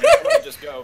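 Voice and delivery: high squeaky laughing voice. Retold or imitated. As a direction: laugh through the quote. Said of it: "He's like".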